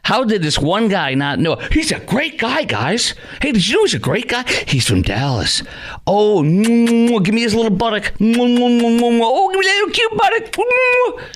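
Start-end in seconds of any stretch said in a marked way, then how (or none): 1.7-4.4 mocking fan. Mocking Scheffler's defenders. 6.1-11.2 mock swooning fan. Host mocks fans swooning over the golfer, hammed-up high fawning moans.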